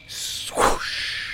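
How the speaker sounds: breathy swoosh noise, unvoiced; whooshing sound. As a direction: make the sound of a swing. Mimics swinging on rope.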